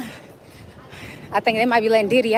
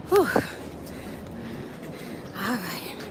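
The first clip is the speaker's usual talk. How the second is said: normal talking voice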